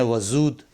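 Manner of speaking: 'वजूद' with z instead of j; wrong pronunciation. Shown as a demonstration. Teacher quotes the common error, mispronounced. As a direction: say 'वजूद' as wa-zood. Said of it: "गलत तलफ़ुज़ करके".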